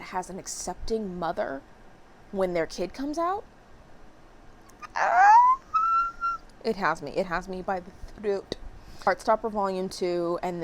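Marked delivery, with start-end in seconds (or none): 4.9-5.6 very high, loud squeal; mock emotional scream. Reader overcome by an accepting-mother scene, high loud squeal. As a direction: let out a high, loud cry.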